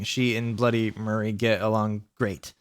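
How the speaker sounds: ordinary delivery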